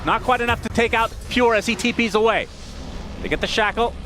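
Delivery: excited esports fight call; urgent running match commentary; live play-by-play. Real, not acted. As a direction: drive the commentary as the fight builds.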